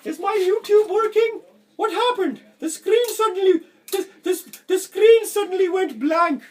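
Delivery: very high, loud squeaky voice for baffled viewers; hammed-up falsetto viewer panic; mock confused viewers. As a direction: squeal it like a panicked viewer whose screen went dark. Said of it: "they're like".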